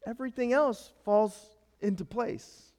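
ordinary talk

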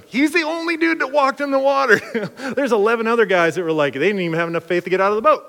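laughing, rushing, building excitement; excited defense. Real, not acted. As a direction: fire up the crowd for Peter. Preacher defending Peter, laughing.

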